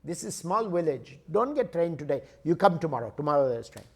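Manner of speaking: put-on Indian accent, firm and matter-of-fact; Indian ticket clerk. Indian clerk tells traveler no train today, Indian accent. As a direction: refuse in an Indian English lilt. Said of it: "He says".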